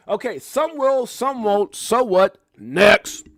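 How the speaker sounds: loud, slow building chant; emphatic dismissive slogan, building in volume; punchy catchphrase. Real, not acted.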